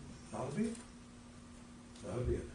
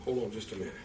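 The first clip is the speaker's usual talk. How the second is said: softer hold on; 7 semitones lower, 6 dB quieter, much faster than usual. Shown as a demonstration. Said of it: "I will say to you".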